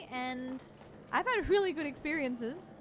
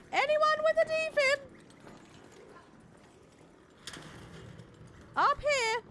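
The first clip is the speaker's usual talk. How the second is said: very high, loud call; yelling across the room; 14 semitones higher, 5 dB louder than usual. Real, not acted. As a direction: shout up to anyone around. Calling for a defib again.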